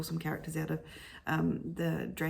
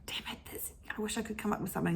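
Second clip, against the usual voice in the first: inner frustration aside; 3 semitones higher, 6 dB quieter, faster than usual. Acted. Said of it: "and then inside you're going".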